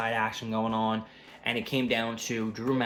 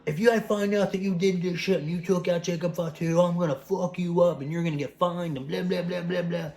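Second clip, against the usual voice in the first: angry authority figure; 7 semitones higher, 3 dB louder than usual. Retold or imitated. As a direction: play the furious general manager laying down the law. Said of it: "Nick Aldis come out and be like".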